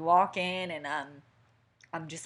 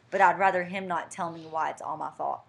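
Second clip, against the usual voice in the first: ordinary talk, nothing marked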